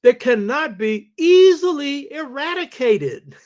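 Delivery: high, loud stress on "easily eradicated"; emphatic stress. Real, not acted. Lecturer quoting a linguist to the audience, stressing "easily eradicated" emphatically. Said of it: "let me stress this".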